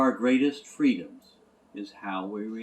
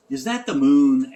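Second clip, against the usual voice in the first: put-on boy's wonder; 4 semitones higher, 13 dB louder than usual. A narrator's reading. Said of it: "asked the boy".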